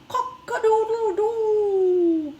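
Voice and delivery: loud, high, drawn-out rooster crow. Acted. Teacher voicing the rooster for the class, loud high crow. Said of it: "Mister Rooster always crowed".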